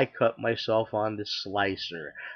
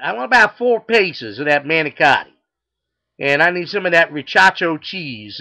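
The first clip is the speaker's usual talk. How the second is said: loud, raised Texan twang; southern accent; 5 semitones higher, 8 dB louder than usual. Acted. Customer adds to his deli order, loud southern accent. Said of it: "he's got a southern accent".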